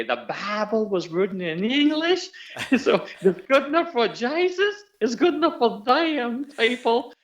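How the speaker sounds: put-on high Southern accent breaking into laughter, slowed; Southern twang, laughing. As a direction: repeat the Southern line while cracking up. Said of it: "she was teaching me how to say".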